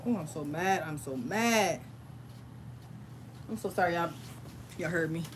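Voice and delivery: low, soft self-reproach; subdued, sheepish apology; embarrassed, mad. Real, not acted. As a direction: say it quietly, half to yourself. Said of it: "it's embarrassing".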